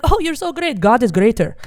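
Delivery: high praise dropping to loud rebuke; mock pious father. Father deflating his daughter's scholarship news, loud mock piety. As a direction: start high, then come down hard. Imitates dad's dismissive reply.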